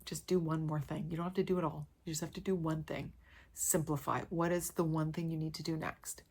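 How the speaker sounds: softly, gently coaxing yourself along; soft reassuring self-talk. Retold or imitated. Talking to herself to get through the day, soft and reassuring. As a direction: talk yourself down, quietly and kindly. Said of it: "I started talking to myself".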